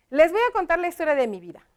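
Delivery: fast, brisk reading aloud; quick read-through. Shown as a demonstration. Teacher rereads the finished text before closing, quick read-through. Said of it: "Lo vamos a leer rápido".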